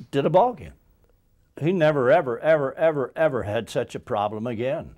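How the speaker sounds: emphatic loud praise. Real, not acted. Old colleague insisting he never relapsed, loud and emphatic. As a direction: hammer every ever with rising force.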